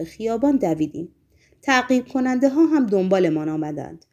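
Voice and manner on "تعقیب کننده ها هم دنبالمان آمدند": ordinary delivery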